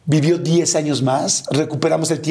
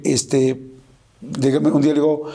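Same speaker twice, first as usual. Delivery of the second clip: usual speaking voice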